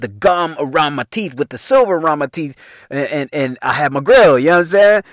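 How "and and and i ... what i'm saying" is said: usual speaking voice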